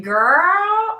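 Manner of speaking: put-on drawn-out, high, loud Valley Girl "girl". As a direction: stretch it upward like a Valley Girl. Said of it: "Did it sound a little Valley Girl?".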